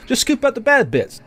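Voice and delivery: high, careless voice; mock dismissive manager. Manager brushing off the roach problem, high and dismissive. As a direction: toss it off like it's nothing. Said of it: "He goes".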